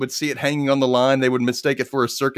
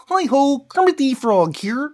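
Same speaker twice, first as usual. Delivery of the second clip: high, slow frog puppet voice; Kermit the Frog; 13 semitones higher, 4 dB louder, slower than usual. Shown as a demonstration. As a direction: do a Kermit impression. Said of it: "I kinda do a Kermit the Frog impression".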